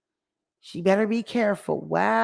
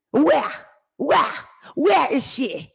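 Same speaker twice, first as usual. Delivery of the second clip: higher, insistent demand; mock interrogation; 5 semitones higher, slower than usual. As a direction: fire each question out on its own. Acted demand to know Tasha's whereabouts.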